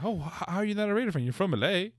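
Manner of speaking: high, fast incredulous mock voice; mocking know-it-all. Host mimicking people who assume she's a Raider fan, fast incredulous mock. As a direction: imitate people who assume too much. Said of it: "Uh, like".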